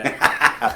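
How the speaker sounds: real high-pitched laugh; amused high laughter. Real, not acted. Laughs at offer of protection.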